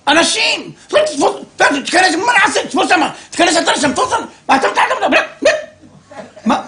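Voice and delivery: hammed-up panic, shouting commands; frantic panic. Neighbor father yelling at his family at the siren, frantic. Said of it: "אבא שלי לא התנהג ככה".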